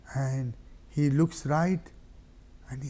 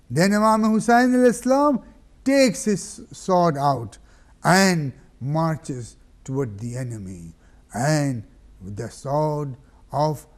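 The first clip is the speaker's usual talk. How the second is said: preaching at full force; 2 semitones higher, 9 dB louder than usual. A narrator's reading. Preacher raising the congregation to the battle. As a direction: drive the battle scene home.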